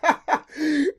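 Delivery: loud, high burst of laughter; big belly laugh. Real, not acted. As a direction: crack up loudly at an impression. Cracks up at the dad impression.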